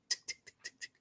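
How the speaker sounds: whispered tick sounds; clock ticking. Acted. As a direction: imitate a ticking clock softly.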